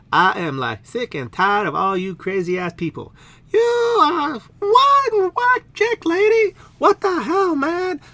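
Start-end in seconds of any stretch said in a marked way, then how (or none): 3.5-8.0 high, loud, slow and shrill princess; high outraged princess. Acted. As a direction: drag it out high and offended. Princess figure voice.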